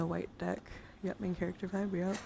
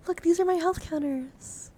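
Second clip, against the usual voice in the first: high, loud, delighted show-off; excited; 10 semitones higher, 6 dB louder than usual. Real, not acted. Streamer showing health counters right side up, excited. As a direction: call for attention in a raised, eager pitch. Showing off item after flipping it.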